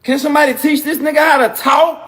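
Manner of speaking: exasperated shout. Acted. Comic begging anyone to teach him talking, loud exasperation. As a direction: raise it into an exasperated appeal. Louder repeat of the complaint.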